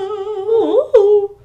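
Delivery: high, loud, drawn-out whoa; playful whoa. Pretend. Co-host playfully echoing the whoa, high and stretched. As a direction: swoop it up high and hold it.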